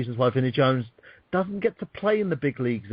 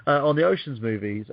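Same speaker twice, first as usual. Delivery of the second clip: ordinary talk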